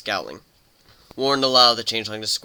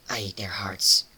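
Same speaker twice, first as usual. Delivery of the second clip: threatening whisper; 3 semitones lower, slower than usual. Acted. Changeling's grim half-joking threat.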